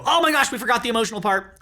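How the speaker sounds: high, fast retold exclamation; mock alarm. Husband seeing both wives weeping, mock alarm. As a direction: blurt it out quickly, as a retold realization.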